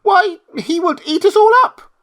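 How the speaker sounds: very high, loud fearful squeak; frightened mouse queen. Acted. Queen fears the lion will eat them, frightened squeak. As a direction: panic as a tiny queen.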